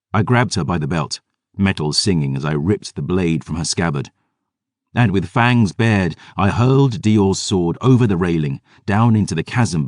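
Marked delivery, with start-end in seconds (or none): none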